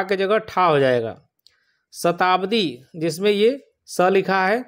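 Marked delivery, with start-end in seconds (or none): none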